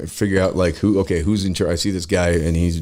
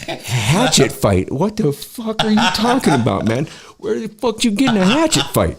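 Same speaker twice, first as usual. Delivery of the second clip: high-pitched incredulous outburst; comic replay of stunned questioning; astonished disbelief; 13 semitones higher than usual. Retold or imitated. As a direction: demand answers, utterly flabbergasted. Astonished reaction to hatchet fight.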